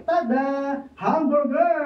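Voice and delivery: slow, drawn-out proud announcement; proud reveal. Acted. Pedro proudly reveals his sandwich to Juan, drawn out. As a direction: present it like a magic trick.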